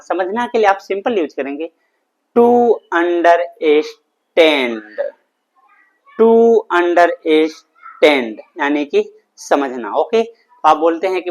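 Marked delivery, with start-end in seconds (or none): 2.4-4.8 louder, syllables stretched far apart; slow model word. Shown as a demonstration. Teacher introducing a new verb to students, loud and very slowly drawn out. 6.2-8.4 louder, syllables stretched far apart; slow model word. Shown as a demonstration. Teacher introducing a new verb to students, loud and very slowly drawn out.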